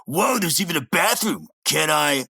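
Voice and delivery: lightly voiced excited cartoon guy; Muscle Man. Host retells Muscle Man finding a bathroom, lightly imitated excitement. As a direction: raise the pitch a little, playfully impressed.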